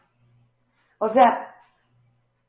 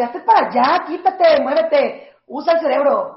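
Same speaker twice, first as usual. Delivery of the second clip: rude shout; 4 semitones higher, 6 dB louder than usual. Retold or imitated. Imagined God yelling rudely.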